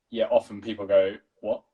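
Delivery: ordinary delivery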